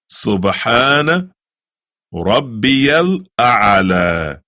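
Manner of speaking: slow, drawn-out Arabic recitation. Shown as a demonstration. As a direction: stretch every syllable of the prayer. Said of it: "Can you say that?".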